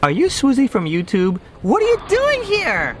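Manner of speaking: high-pitched excited squeal of recognition; gushing fan voice. Retold or imitated. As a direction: mimic a starstruck fan, pitch shooting up. Retells a fan spotting him.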